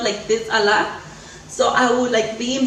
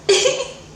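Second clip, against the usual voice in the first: high rueful laugh; laughing about painful breastfeeding; 3 dB louder than usual. Real, not acted. Laugh after "It hurts.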